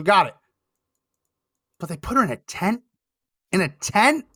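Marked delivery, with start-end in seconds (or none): 1.8-4.2 rising, disbelieving question; can't believe what you see; incredulous. Real, not acted. Incredulous at the clip.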